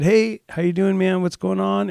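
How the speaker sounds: chirpy, upbeat greeting; sunny hey-man to a neighbor stacking blocks; cheerful greeting. Retold or imitated.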